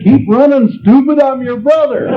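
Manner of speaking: high, sharp rabbit retort; snappy rabbit. Retold or imitated. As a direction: fire it back at the dumb brother. Other rabbit snaps back.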